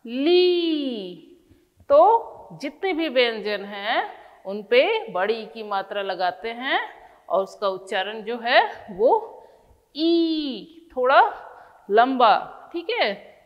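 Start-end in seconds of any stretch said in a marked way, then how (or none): 0.1-1.2 ली with the ई stretched out; ली, drawn-out ई sound; long ee in ली. Shown as a demonstration. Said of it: "ल का उच्चारण होगा". 10.0-12.7 ई held a little longer, then लंबा stressed and louder; teacher showing the long ई sound. Shown as a demonstration. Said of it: "उसमें थोड़ा लंबा बोलना पड़ जाता है".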